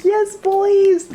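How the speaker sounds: high, loud victorious whoop; triumphant cheer. Real, not acted. Stomping around her room in the boots, a high loud victory whoop. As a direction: cheer like you just won.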